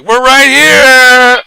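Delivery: very high, loud, drawn-out squeal; camp shout. Retold or imitated. Drunk friends answering the tour guide, campy drawn-out shriek. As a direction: shriek it out like two drunk queens. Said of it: "my friend and I go like".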